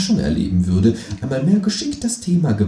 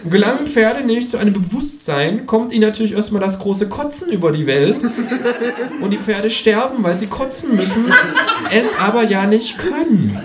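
grandiose kangaroo; 12 semitones higher than usual. Acted. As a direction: preach the horse theory with pomp. Kangaroo's grand horse theory. (pathetisch dozierendes Känguru)

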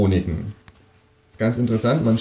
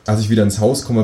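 usual speaking voice

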